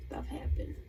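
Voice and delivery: soft whispered aside; whisper, resigned. Real, not acted. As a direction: breathe it out under your breath. Wistful closing aside.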